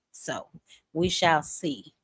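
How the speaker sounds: slow, drawn-out catchphrase. Real, not acted. Host drawing out her catchphrase to viewers, slowly. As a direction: let each word hang, teasing.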